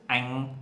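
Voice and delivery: "anh" with a northern clear a and nasal ending. Shown as a demonstration. Teacher demoing northern "anh" to learners. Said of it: "they pronounce like this".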